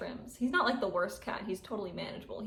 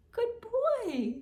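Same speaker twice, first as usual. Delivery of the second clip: high-pitched pet praise; 14 semitones higher, 3 dB louder than usual. Real, not acted. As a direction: praise the cat in a high coo. Praising the cat.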